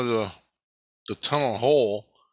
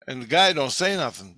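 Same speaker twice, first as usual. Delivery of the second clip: normal talking voice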